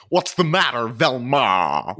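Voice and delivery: villain voice. Retold or imitated. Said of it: "and he goes".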